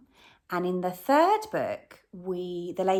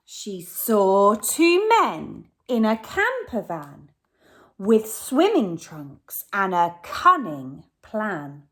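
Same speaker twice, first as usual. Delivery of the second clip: louder, slow, ominous storytelling; dramatic narration; 7 dB louder, slower than usual. A narrator's reading. Teacher narrating the robbers' wicked plan, dramatic. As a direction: tell of the villains with relish.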